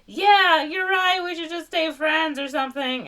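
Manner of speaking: high, loud egg voice; hammed-up villain giving in; mock Humpty. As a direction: squeak it like a silly egg. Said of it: "Humpty Dumpty's like".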